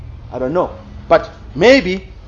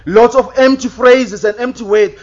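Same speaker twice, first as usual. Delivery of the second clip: usual speaking voice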